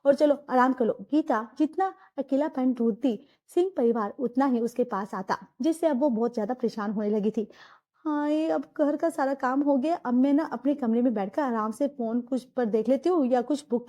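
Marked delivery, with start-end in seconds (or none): 1.1-7.5 low, even storyteller voice; story narration. A narrator's reading. Narrator telling the family story, in a low even narration. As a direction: narrate the scene calmly, pitched lower.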